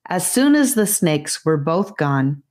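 usual speaking voice

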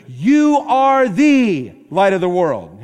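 loud, slow proclamation. Retold or imitated. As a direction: proclaim it boldly as Jesus would. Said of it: "Jesus would have raised his voice".

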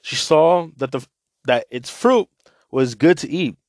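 scripture reading to listeners; Bible reading. Acted. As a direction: read the scripture out to the group. Reads Bible passage aloud.

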